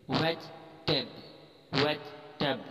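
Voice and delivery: "वेज" and "टेब" split apart with no "ji"; syllable by syllable. Shown as a demonstration. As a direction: say "वेज", pause, then "टेब". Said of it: "इसको अलग-अलग पढ़ेंगे". ("वेज" और "टेब" अलग-अलग)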